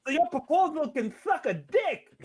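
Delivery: high, laughing Mike Tyson voice. Retold or imitated. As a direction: keep the squeaky Tyson going through a laugh. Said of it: "sound like Mike Tyson".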